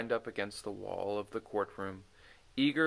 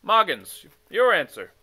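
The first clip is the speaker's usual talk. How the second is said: loud curt summons; king calling; 6 semitones higher, 12 dB louder than usual. Acted. The king calls Moggins forward, loud. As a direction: demand the next answer.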